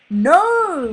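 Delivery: high, loud despairing no; anguished scream. A narrator's reading. Father watching son netted, loud high scream. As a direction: scream as he's taken. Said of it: "Marlin screamed".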